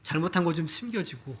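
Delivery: ordinary talk, nothing marked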